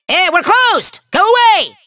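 high-pitched, snappish brush-off; peevish villain outburst; irritated dismissal. Acted. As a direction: shoo away an unwanted visitor. Villain shooing off a visitor.

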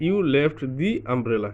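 "the" said as "dee" before umbrella, slow. Shown as a demonstration. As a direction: give "the" a clear "dee" sound, very slowly. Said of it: "আমরা বলছি দি উচ্চারণ করব".

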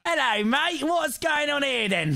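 put-on high, drawn-out London accent; mock London accent. Mocking someone who lived in London, hammed-up London accent. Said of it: "Lives in London for three years".

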